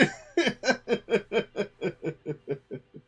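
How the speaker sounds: long, high, helpless laugh; a long run of high laughter, tapering off; cracking up. Real, not acted. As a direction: laugh it all the way out. Cracking up at own rude deck.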